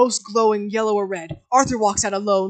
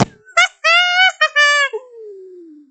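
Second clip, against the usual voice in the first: shrieking high cackle; wild high laugh; 9 dB louder than usual. Acted. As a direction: burst into a loud, high unhinged laugh. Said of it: "He laughs to himself".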